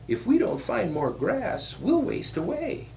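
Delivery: very high little billy goat; small high-pitched creature, pleading for food. A narrator's reading. Little goat to his brothers about grass, high small voice.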